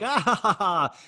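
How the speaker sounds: imitating a dumb laugh at the show; laughing along like a fan; mock laugh. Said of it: "I was like".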